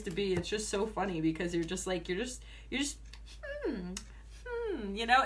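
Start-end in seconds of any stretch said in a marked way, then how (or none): none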